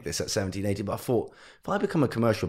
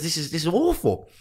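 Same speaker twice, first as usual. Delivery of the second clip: fuming mum; 8 semitones higher than usual. Retold or imitated. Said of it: "My mum was fuming".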